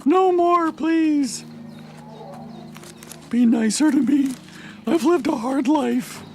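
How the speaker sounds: very high pleading squeal; squeaky creature voice. Retold or imitated. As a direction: whine like a tiny begging creature. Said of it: "He's like".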